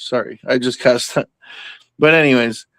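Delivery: ordinary talk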